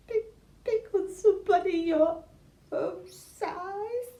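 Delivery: high, loud, sobbing elephant voice; crying elephant voice. Shown as a demonstration. Teacher demonstrating an elephant voice, crying and high. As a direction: wail it like a weeping elephant. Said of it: "that's just an example".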